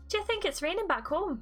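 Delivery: high, overdone attempt at Scouse; failed Liverpudlian accent. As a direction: badly attempt Scouse, pitched well up. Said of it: "hear what a Liverpudlian accent sounds like".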